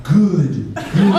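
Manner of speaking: low, slow menacing praise; Sith Lord. Retold or imitated. Trainer praising the vomiting client like a Sith Lord, lightly low menace. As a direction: purr it like an evil emperor. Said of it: "Like he was a Sith Lord".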